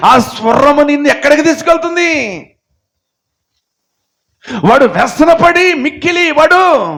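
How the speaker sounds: high, loud sermon at full force; fiery preaching. Real, not acted. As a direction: thunder it at the congregation. (ఉద్రేకంగా గట్టిగా ప్రసంగం)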